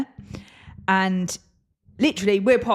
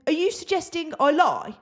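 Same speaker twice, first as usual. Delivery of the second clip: mock innocent; 8 semitones higher than usual. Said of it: "I go".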